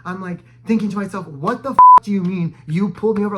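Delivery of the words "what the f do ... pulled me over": loud, exasperated disbelief; retold indignation. Driver silently fuming at officer's false stop, indignant. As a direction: burst out louder, as if affronted.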